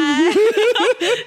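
loud burst of laughter. Real, not acted. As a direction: crack up loudly. (放声大笑)

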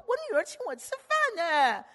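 high, loud proud boast; proud dad. Retold or imitated. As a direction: crow about your daughter like a proud father. Dad boasting about daughter. (骄傲的爸爸高声炫耀)

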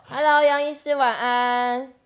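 loud cheerful call with laughter. Real, not acted. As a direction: call the guest in over a laugh. Calling out to the guest.

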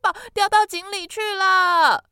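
高声急喊长名字; loud calling. Acted. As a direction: belt the long name out. 弟弟向阿伯喊名字. (弟弟向阿伯大喊哥哥的名字)

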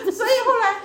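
loud, high burst of laughter; big laugh. Real, not acted. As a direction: let a big laugh break out. Laughing at friend's remark.

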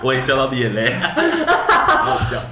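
laughing while talking; after laughing hard. Real, not acted. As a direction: still laughing to tears.

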